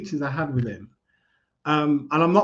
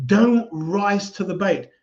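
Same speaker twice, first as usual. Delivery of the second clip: ordinary talk, nothing marked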